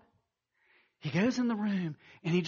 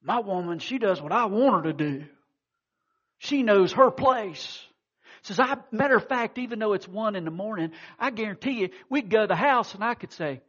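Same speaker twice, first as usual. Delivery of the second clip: bragging loudly to his drinking buddies, pitched up; boastful drunk husband; 6 semitones higher, 7 dB louder than usual. Retold or imitated. A drunk husband boasting to his bar buddies, loud and cocky. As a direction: swagger through it like a man showing off at the bar. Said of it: "he said".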